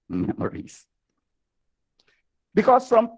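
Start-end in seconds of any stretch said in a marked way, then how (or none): 0.1-0.9 laughing whisper. Real, not acted. Repeat of memories, laughing.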